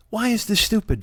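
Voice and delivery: ordinary talk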